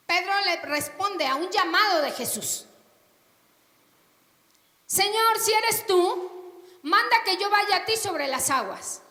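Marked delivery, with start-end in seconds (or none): 4.9-9.0 Peter calling out. Acted.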